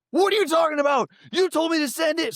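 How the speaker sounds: very high, loud-ish indignant comeback; imitating arguing friend. Friend arguing over a sent text, high indignant imitation. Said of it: "him and I are arguing".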